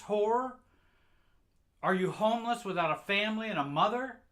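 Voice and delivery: high, loud berating shout; furious mother. Retold or imitated. Mother screaming at her child about the clothes, furious shout. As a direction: tear into the child over clothes.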